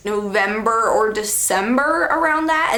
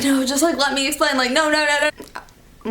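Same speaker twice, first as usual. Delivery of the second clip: higher mock begging, trailing away at the end; mock tearful pleading; 4 semitones higher than usual. Boyfriend begging to explain, mock tearful pleading. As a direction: imitate a crying boyfriend, pitched higher. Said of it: "Just starts crying".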